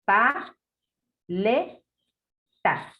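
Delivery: "Pa", "le", "ta" each said alone with long pauses between; syllables split apart. Shown as a demonstration. Teacher dictating syllables for the child to blend, spaced out. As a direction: chop the word into separate syllables. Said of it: "Te voy a decir las partes de una palabra".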